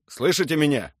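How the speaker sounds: demanding, projected call. A narrator's reading. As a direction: call to the enemy over the radio. Commander hailing enemy over radio.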